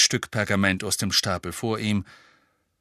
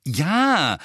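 impatient; 5 semitones higher, 6 dB louder than usual. Acted. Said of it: "ungeduldig und leicht verzweifelt zugleich".

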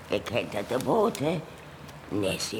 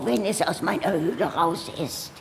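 normal talking voice

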